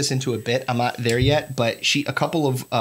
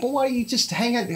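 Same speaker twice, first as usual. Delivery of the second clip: retold self; 9 semitones higher than usual. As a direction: coax her to stay on the line. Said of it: "I was like".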